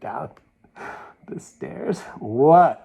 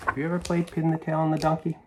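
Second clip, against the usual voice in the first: usual speaking voice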